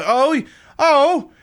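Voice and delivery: loud, high mock British bruv; mock British accent. Streamer mimicking an oi bruv at the hospital, loud mock British accent. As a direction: put on an indignant, hammy British shout. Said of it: "I attempt to do a British accent".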